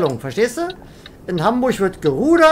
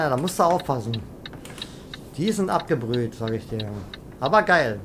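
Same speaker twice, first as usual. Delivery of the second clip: usual speaking voice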